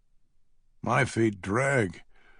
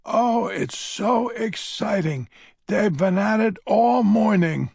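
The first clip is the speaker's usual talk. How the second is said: put-on excited old lady; 10 semitones higher, 6 dB louder than usual. A narrator's reading. Old lady tells Jacob the circus has arrived, high excited gush.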